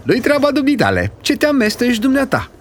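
high and fast, indignant; aunt rebuking the young man; snapping aunt. Acted. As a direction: put the meddler in his place. Aunt snaps at the stranger.

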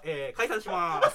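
stretched out slowly and rising in volume; mock announcement. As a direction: proclaim it with long drawn-out final vowels. (大げさに伸ばした解散宣言)

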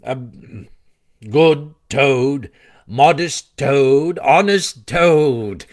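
slow, drawn-out sarcastic tribute; mocking praise. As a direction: stretch each compliment with mock sincerity. Weasel mockingly praises Toad in speech.

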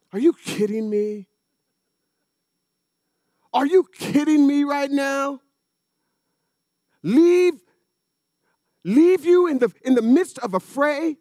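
as if the idea of leaving is absurd; incredulous outburst. Real, not acted.

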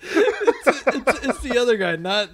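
high laughing words; laughing hard. Real, not acted. Laughing at his own mixup.

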